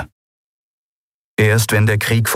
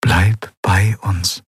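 slow, tender and comforting; soothing plea; much slower than usual. Acted. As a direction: gently beg her to stay. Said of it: "das tröstende Lächeln ihrer Mutter".

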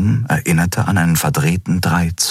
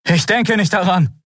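higher defiant retort; defiant Kai; 14 semitones higher than usual. A narrator's reading. Kai refusing Kero while running, higher defiant. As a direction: throw it back over your shoulder.

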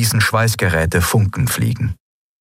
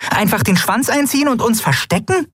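very high, scornful little voice; indignant tiny creature. A narrator's reading. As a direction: scoff at hiding.

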